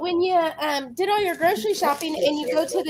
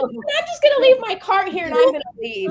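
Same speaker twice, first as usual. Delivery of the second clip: pitched-up, fed-up declaration; high exasperated retort; 9 semitones higher, 5 dB louder than usual. Retold or imitated. Telling friends how she quit the deli, high exasperated. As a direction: announce leaving in disbelief. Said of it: "And I go".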